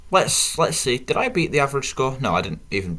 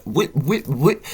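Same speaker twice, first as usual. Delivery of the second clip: imitated stutter, pitched up; higher, repeated stumbling syllable; mock stutter; 5 semitones higher, slower than usual. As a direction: mimic your own stutter in short repeated bursts. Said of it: "Don't know what that stutter was".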